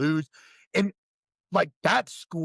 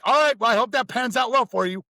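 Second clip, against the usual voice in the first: high, loud dry sarcasm; sarcastic instructor; 8 semitones higher, 6 dB louder, faster than usual. Retold or imitated. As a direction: wish him luck you don't mean. Instructor's dry dismissal quoted.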